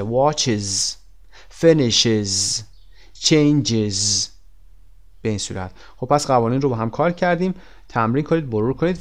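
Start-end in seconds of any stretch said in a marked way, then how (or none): 0.0-4.3 "Watches", "finishes", "changes" one by one with a buzzing "iz" ending; final IZ ending. Shown as a demonstration. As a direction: sound out the extra syllable ending each word.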